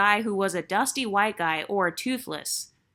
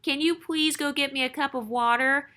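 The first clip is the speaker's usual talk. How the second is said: higher-pitched, wheedling mock baby talk; put-on little baby voice, raised pitch; 5 semitones higher than usual. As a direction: imitate her pleading in a babyish voice. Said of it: "she used this baby voice like".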